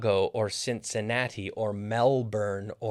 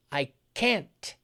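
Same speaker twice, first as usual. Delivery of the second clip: CAN'T set apart and higher, with a clear T; emphatic correction; 4 semitones higher than usual. Retold or imitated. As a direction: correct them by isolating CAN'T. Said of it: "And he said, No".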